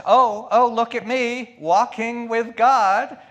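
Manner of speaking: high, loud mock show-off; showy boaster. Preacher mocking a boastful believer, loud and high. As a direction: ham up a smug pious braggart. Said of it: "Don't be all in your face about it".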